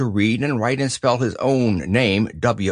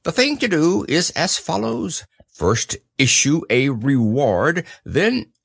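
Owl, pompous; 4 semitones higher than usual. Acted. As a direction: lecture importantly as a wise owl.

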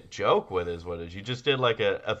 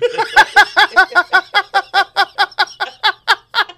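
high laughing fit; 13 dB louder than usual. Real, not acted. Laughing at own impression.

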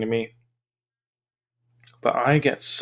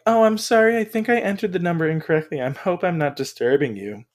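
apologetic scammer; 6 semitones higher, faster than usual. Retold or imitated. Scammer apologizing for wrong number, hammed-up. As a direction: gush an overdone apology. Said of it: "And they're like".

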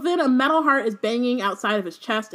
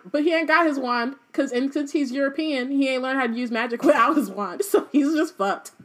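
normal talking voice

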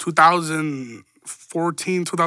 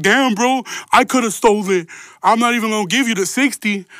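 retold boast, louder and higher; mock gloating; 4 semitones higher, 4 dB louder than usual. Homie gloating outside the store, loud and cocky. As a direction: brag like he got one over on you.

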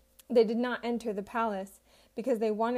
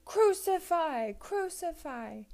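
higher, slow drawn-out cry of crucify; slow, high shouted demand of the crowd; shouting crowd; 7 semitones higher, slower than usual. A narrator's reading. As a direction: chant it like a baying mob. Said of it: "they shouted".

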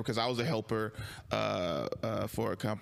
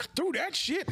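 mock defiant blurt, much higher pitched; retold defiant answer. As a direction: fire it back high and defiant. Answering about package, retold.

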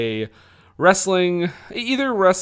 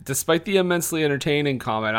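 ordinary talk

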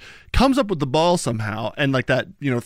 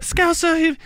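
Scouse accent attempt. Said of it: "your best, uh, Scouse accent".

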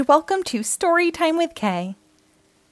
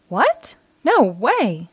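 surprised disbelief; 3 dB louder, much slower than usual. Acted. Child reacts to dragon idea.

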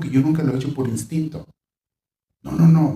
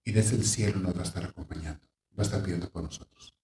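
put-on softer, gentle comfort to a child; tender comfort; 5 semitones lower, 5 dB quieter than usual. Relative gently telling a grieving child mami went to heaven, soft and tender. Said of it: "se le dice".

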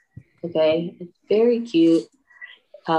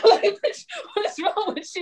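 laughing; 13 semitones higher than usual. Real, not acted. Mocking Arnold's death scene, laughing through the words. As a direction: giggle it out in disbelief.

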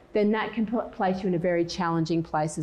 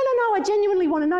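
fast, high, louder mock protest; insistent protest; 13 semitones higher, 5 dB louder, much faster than usual. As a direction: rush out a high-pitched denial. Acted protest in retold argument.

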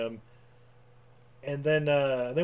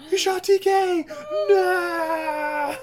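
high, loud anguished shout; anguished cry; 9 dB louder, much slower than usual. Retold or imitated. Officer sees T K shot and cries out in high, loud anguish. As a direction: cry out as if your partner just fell.

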